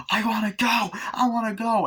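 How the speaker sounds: high mock-hysterical pleading; hysterical, imitated. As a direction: beg to leave in playacted hysteria. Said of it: "she's getting all hysterical".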